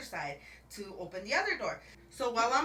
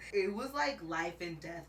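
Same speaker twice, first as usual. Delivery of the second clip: ordinary delivery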